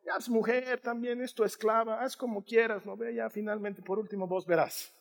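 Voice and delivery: soft, give-up tone of a weak husband; mock-resigned husband brushing it off; resigned shrug. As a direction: toss it back to her quietly, not your problem. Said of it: "le dijo".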